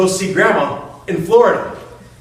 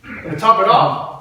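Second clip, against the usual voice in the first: ordinary delivery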